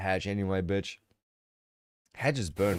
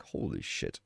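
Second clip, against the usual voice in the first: low, soft, stunned holy shit; mock shock; 9 dB quieter than usual. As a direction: let it drop out of you in disbelief. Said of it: "And I'm like".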